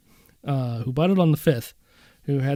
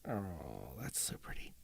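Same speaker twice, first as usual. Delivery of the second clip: faint hushed whisper; awed whisper; 12 semitones lower, 21 dB quieter than usual. Real, not acted. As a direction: breathe it out in quiet awe. Admiring guitar.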